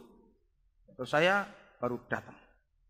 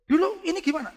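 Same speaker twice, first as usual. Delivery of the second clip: surprised; 7 dB louder than usual. Retold or imitated. Pastor quoting his own surprise.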